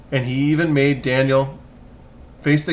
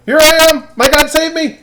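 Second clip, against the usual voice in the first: calling back; 10 dB louder than usual. Acted. Daniel answers from den.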